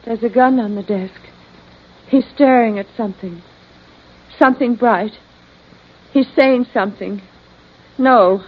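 halting hypnotized report; in a trance. Acted. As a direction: pause between each thing seen. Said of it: "she's in a trance".

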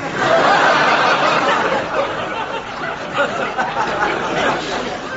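high, long laugh; long cackle. Acted. Will cackles at his brother's innuendo, high and long. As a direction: cackle at the joke.

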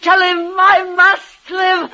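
high, straining to stay up; desperate insistence. Acted. Dying woman insists to her lover she is well, desperately. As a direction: fight the weakness, pleading.

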